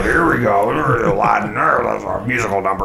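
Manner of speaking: mock grind through it like a crank; mumbled, churned-out showman imitation; mechanical cranking voice. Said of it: "like, cranking it out".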